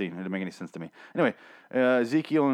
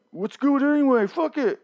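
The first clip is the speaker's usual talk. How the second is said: much higher, louder careless voice; reckless Eric; 14 semitones higher, 4 dB louder than usual. Retold or imitated. Reviewer imitating Eric's reckless shrug, in a high voice. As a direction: toss off life like it means nothing. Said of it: "And Eric does this, like".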